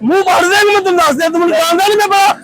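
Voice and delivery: furious at the beggar, shouting a threat; angry shouting. Acted. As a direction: bark threats at the pest, rising to a yell. Heated street quarrel over money.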